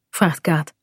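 ordinary delivery, nothing marked

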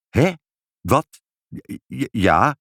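caught-out, halting admission; hesitant, broken little answers; flustered stammer. Acted. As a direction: stumble into a sheepish yes.

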